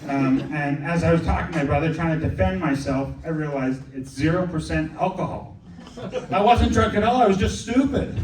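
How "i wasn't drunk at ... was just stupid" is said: higher, louder self-mocking outburst; exclaiming punchline. Real, not acted. Comic tells the crowd he was stupid, exclaiming loudly. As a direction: blurt out the punchline at himself.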